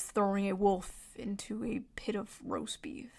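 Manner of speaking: soft unvoiced whisper, slowed down; whispered punchline. Real, not acted. Streamer whispering the punchline of her joke to chat. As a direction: whisper the twist of the joke as an aside.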